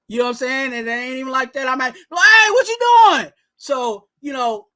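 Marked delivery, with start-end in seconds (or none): 1.9-3.3 loud, high-pitched yell at the TV; squealed, hammed-up outraged holler. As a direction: shoot way up high in exasperated disbelief. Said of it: "that's where Twan getting the high voice".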